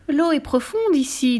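high, slow, coaxing fox; sly voice. A narrator's reading. Fox warns the gingerbread man, sly voice. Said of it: "d'une voix rusée".

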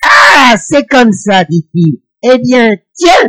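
high, loud, shouting threat; irritated frost spirit. Acted. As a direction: yell it like an exasperated frost spirit. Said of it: "ça l'énerve".

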